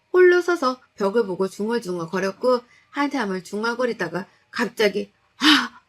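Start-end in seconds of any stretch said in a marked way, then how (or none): 5.4-5.8 loud sudden shout of 아; roar. Acted. Husband lunging at his wife, sudden loud roar. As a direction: bellow out suddenly. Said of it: "하며 고함을 치며".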